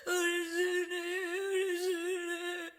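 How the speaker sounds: slow, drawn-out tearful calling of the name; tearful farewell. Retold or imitated. Mother saying goodbye through tears, slow and tearful. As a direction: stretch each word out like a parting. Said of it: "눈물로 암시적으로 이별을 구했어요".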